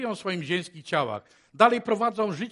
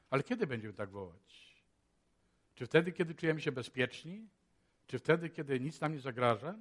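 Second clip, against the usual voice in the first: normal talking voice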